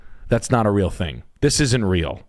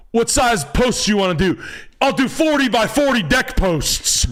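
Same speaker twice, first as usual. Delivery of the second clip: mock builder; 11 semitones higher than usual. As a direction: play the macho builder ordering lumber. Acts out a builder ordering posts.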